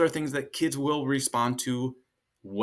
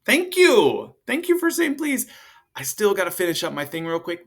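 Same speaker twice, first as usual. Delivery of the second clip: much higher, louder, cheerful thank-you; praising parent; 8 semitones higher, 7 dB louder than usual. Retold or imitated. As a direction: gush praise to your child. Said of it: "by saying".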